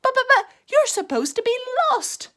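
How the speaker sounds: high, fast sputtering; flustered stammer. A narrator's reading. Rabbit to Tigger, high fast flustered stammer. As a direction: sputter in disbelief.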